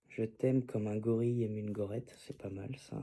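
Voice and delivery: low, soft thinking aloud; murmured musing. Real, not acted. Poet testing a first line alone, murmuring. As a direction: murmur a draft line to yourself.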